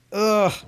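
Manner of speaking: loud, high groan while stretching. Real, not acted. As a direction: strain out a groan as you stretch. Groaning as back hurts.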